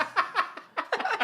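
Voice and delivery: sudden high laugh; burst of laughter. Real, not acted. Laughs at lizard claim.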